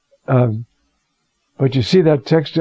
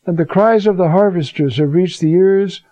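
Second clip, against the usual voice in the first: scripture reading; 4 semitones higher than usual. Acted. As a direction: thunder the warning to the rich. Reads James aloud.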